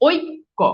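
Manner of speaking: ঐক্য slowly split as "oik", "ko"; teacher's careful, broken-up ঐক্য. Shown as a demonstration. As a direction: sound out the o-i glide in ঐক্য and pause before the last syllable. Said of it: "ও রস্বই".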